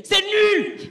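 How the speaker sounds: very high, loud yell of C'est nul; a heckler screaming at the comic's first word; yelled heckle. Retold or imitated. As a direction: blurt it out to ruin the act. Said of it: "il crie".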